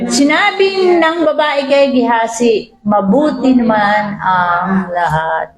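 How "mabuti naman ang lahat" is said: calm reassurance. Retold or imitated. Grieving mother calmly says all's well.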